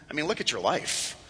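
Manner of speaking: low, soft scoff with a small chuckle; hushed, chuckling taunt; mocking serpent. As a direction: quietly sneer at their faith. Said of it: "The serpent laughs at you and says".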